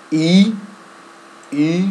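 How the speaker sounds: ای said high, loud and heavy; strong emphatic ای; thick firm ای. Shown as a demonstration. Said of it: "ای غلیظ و محکم".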